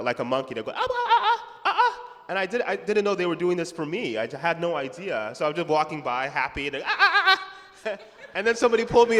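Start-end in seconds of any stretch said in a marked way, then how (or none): 0.7-2.0 high, loud hooting "ah ah" like schoolkids teasing; mock monkey yells. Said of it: "yelling like a, like a monkey". 6.8-8.0 high, loud hooting "ah ah" like schoolkids teasing; mock monkey yells. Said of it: "yelling like a, like a monkey".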